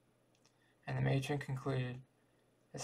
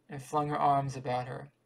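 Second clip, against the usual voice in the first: usual speaking voice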